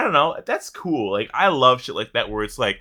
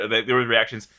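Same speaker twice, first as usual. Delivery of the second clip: ordinary talk, nothing marked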